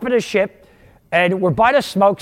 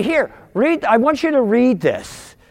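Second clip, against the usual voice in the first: lightly imitated French accent, pitched higher; heavy French accent; 6 semitones higher than usual. Grandmother handing her grandson a Bible, lightly imitated French accent. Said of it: "in this real heavy French accent".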